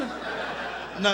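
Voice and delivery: usual speaking voice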